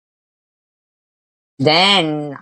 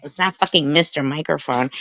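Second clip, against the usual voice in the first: normal talking voice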